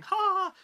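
loud, high forced "haa"; badly faked burst of audience laughter; terrible fake laugh. Shown as a demonstration. Comic showing a crowd start laughing, terrible loud fake laugh. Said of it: "That was a terrible laugh".

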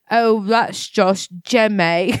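very slow, bad British accent; botched, dragging British imitation. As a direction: stretch it out like a tipsy British singer. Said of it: "you sound like drunk [name]".

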